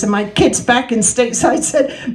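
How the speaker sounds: usual speaking voice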